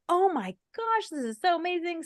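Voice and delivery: high, soft gasp of delight; delighted mom. Retold or imitated. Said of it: "she was like".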